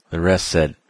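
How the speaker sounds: ordinary delivery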